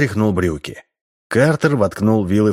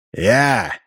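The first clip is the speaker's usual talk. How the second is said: ordinary delivery, nothing marked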